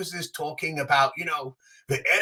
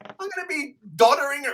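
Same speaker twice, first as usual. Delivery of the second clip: much higher, incredulous whine; mock exasperation; 13 semitones higher than usual. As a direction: sound put out by his stamina. Mock complaint about aging.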